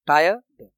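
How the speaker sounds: Tired split, with a gap before the ending; broken into syllables. Shown as a demonstration. Teacher showing where the sound breaks, tired split. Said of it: "एक sound टूट रहा है".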